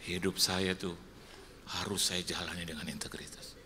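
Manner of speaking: whispered punchline; sly whispered aside to the congregation. Real, not acted. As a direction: drop to a whisper for the joke. Drops voice on punchline.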